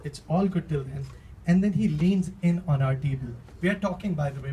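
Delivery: ordinary delivery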